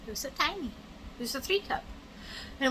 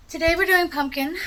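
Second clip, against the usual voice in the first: winded after carrying groceries; talking while catching breath; 7 semitones higher, 7 dB louder than usual. Real, not acted. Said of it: "Sorry I'm out of breath".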